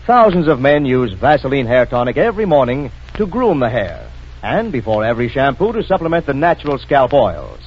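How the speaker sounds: ordinary talk, nothing marked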